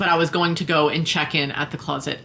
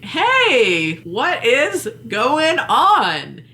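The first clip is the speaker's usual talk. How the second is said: higher, very slow, drawn-out greeting; drawn-out hey; 7 semitones higher, 4 dB louder, much slower than usual. Retold or imitated. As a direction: stretch it like you smell trouble. Confronting volunteer in closet.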